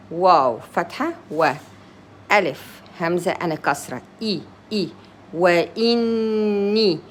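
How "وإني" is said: slow وإني, holding the doubled n. Shown as a demonstration. Teacher explains the shadda mark, holding a double n in وإني. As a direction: lean long and level on the n of وإني. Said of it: "That means I give two N".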